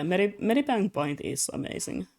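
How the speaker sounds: ordinary talk, nothing marked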